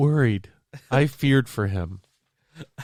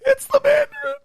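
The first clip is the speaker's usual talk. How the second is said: gasp, then very high fangirl shriek; mock panicked squeal; 6 dB louder than usual. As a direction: squeal in thrilled, hammed-up alarm. Acting out own thrilled panic at news.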